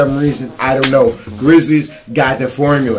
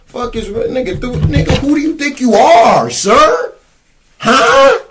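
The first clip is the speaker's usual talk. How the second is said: high, sharp, taunting challenge; mock confrontation; 8 semitones higher, slower than usual. Fan taunting Curry after the game, high and scornful. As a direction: square up and mock him.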